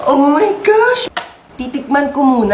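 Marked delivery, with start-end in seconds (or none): 0.1-1.1 delighted gasp. Acted. Child sees cheesecake.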